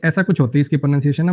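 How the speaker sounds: ordinary talk, nothing marked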